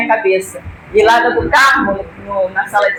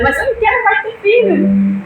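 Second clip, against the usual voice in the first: protesting in a much higher voice; protesto agudo; 11 semitones higher than usual. Retold or imitated. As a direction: push back, pitched way up. Discussão com a irmã sobre filho. (protesto bem agudo)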